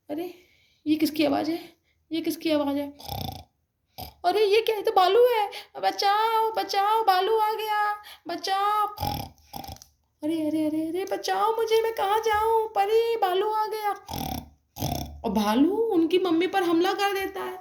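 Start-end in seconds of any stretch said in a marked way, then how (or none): 4.2-13.9 high, frantic cries of bachao; a terrified mother shrieking as the bear comes; mother screaming for help as the bear attacks, high and frantic; panicked cry for help. Acted.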